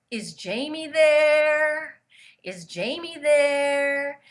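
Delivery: put-on slow, high French-accented call, stretching the word there; a ghostly Frenchman asking for Jamie, the there dragged long; French accent, drawn out. A French-accented voice on the phone asks for Jamie, slowly stretching there. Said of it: "He said the voice had an accent".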